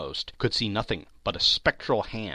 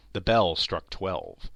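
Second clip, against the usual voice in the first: usual speaking voice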